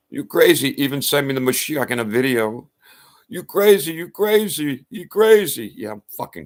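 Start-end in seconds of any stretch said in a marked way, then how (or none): 0.1-0.8 high, loud mimicry of accusers; mocking accusers. Relatives and friends calling him crazy, mockingly shrieked. 3.3-5.7 very high, loud mock accusation, repeated; mocking accusers. Relatives and friends calling him crazy, mocking high yell.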